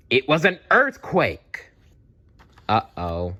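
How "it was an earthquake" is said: sudden high alarm. A narrator's reading. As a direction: announce the shock. Sudden earthquake narrated.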